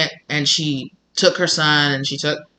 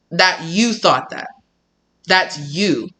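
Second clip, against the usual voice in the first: ordinary delivery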